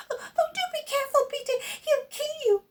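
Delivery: very high, fast, panicked warning; frightened cry. Acted. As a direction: shriek a warning in fear. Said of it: "cried Luba".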